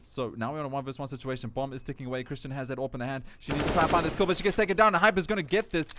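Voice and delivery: loud, high-pitched clutch call; urgent esports caster at the round's climax; excited play-by-play. Acted. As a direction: drive the call hard as the kills land.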